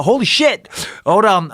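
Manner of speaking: high startled exclaim; shocked. Retold or imitated. Fighter retelling opponent falling, high startled disbelief. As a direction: blurt it out in disbelief. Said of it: "I'm like".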